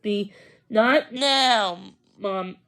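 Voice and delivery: slow, lightly voiced firm mom; a hint of a mother setting a limit. Mom pulling the bean away, mildly firm and slow. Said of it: "Mom said firmly".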